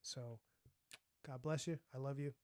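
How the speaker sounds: ordinary delivery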